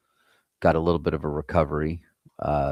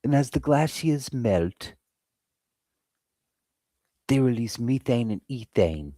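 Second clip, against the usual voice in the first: David Attenborough; 7 semitones higher than usual. Retold or imitated. Streamer spoofing a nature documentary about melting glaciers, high British narrator voice. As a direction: narrate it in a put-on British accent, pitched high. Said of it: "like David Attenborough".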